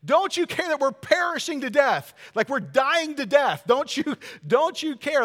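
hammed-up terror of men on a sinking boat; frantic plea over the storm; panicked disciples. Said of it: "they're freaking out".

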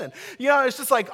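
loud, high, gushing happy-ending hype; excited. Pretend. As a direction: sell the fairy-tale ending with big enthusiasm. Imagined happy ending, hyped.

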